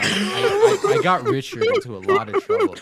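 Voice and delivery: high, loud, through laughter; laughing hard. Real, not acted. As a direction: squeal it out while cracking up. Cracking up at own story.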